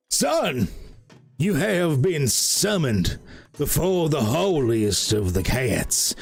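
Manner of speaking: slow, grand proclamation; a dark cat announcing a holy summons; solemn herald cat. Acted. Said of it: "another cat ... goes".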